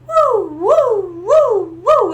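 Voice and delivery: high, loud wailing siren woos; loud vocal siren imitation; imitated siren. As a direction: wail like an approaching siren. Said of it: "I hear the sirens".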